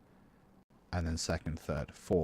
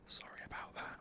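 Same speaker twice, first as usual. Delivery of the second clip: softly whispered sorry; whispered apology; 15 dB quieter than usual. Real, not acted. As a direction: whisper it as an aside. Apologising for the choppy audio.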